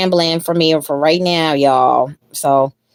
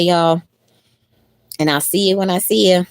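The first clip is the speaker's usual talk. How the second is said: ordinary delivery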